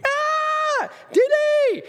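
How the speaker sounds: very high, loud mock shriek of his mom; hammed-up falsetto scream for Didi; imitated scream. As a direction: shriek the name like a terrified mother. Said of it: "I would hear her scream".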